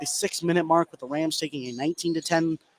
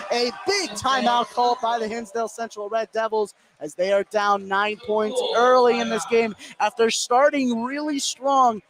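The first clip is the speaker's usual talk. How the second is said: higher, driving sports call, rising on the layup; live play-by-play; 7 semitones higher than usual. Real, not acted. Caller narrating the fast break and timeout, excited. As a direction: ride the action up the court.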